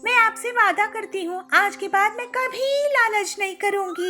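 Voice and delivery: high, earnest bride voice; remorseful young bride. Acted. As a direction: promise sincerely, pitched high. Pilu apologizes and promises.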